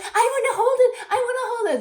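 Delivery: high, loud, laughing plea; eager kids. Retold or imitated. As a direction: beg like an excited child, laughing. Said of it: "they're like".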